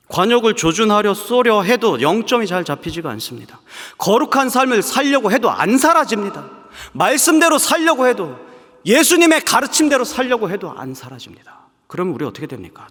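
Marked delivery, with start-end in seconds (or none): 0.1-11.7 loud, high fervent preaching; preaching at full force. Acted. Preacher urging the congregation, full-force preaching. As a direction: drive each line home from the pulpit.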